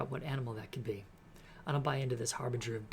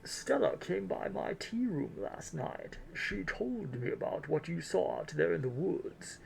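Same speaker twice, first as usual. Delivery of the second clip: put-on higher, slow old grandma voice; drawn-out elderly lady, put on thick; 5 semitones higher, slower than usual. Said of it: "my grandma voice".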